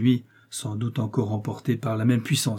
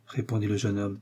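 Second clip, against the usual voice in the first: usual speaking voice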